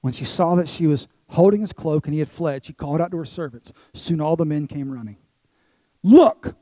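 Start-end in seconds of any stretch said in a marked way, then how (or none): 6.0-6.5 sudden loud, high cry for attention; calling out accusingly. Acted. Potiphar's wife summoning servants to accuse Joseph, calling out loudly. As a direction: call the servants over with one sharp, loud word.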